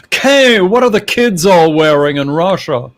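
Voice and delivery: mock Russian press lady asking Kay; imitating the lady. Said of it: "she talks like this".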